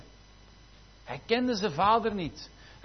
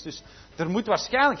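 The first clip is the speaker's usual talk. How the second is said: ordinary delivery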